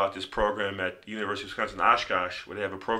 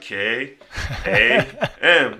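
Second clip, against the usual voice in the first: sure of himself, louder and laughing; confident; 3 semitones higher, 7 dB louder, much slower than usual. Retold or imitated. Teen proudly misspelling for the doctor, confident and loud. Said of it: "like, confident".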